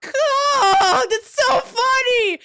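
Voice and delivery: can barely speak for laughing; high, slow, tearful laughter; crying laughing. Real, not acted.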